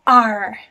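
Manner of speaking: R loud, gliding down; fourth tone. Shown as a demonstration. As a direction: say R like a pirate, falling in pitch. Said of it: "it's in fourth tone going down".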